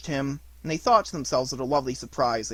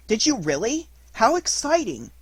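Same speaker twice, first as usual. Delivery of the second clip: put-on much higher, eager child's reaction; excited interest; 9 semitones higher, 5 dB louder than usual. A narrator's reading. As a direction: marvel at the news, pitched high. Christopher Robin reacts to news.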